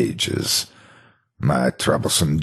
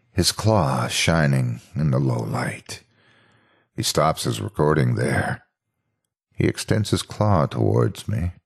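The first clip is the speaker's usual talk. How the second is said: plain reading voice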